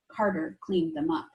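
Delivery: plain reading voice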